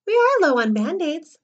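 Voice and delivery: usual speaking voice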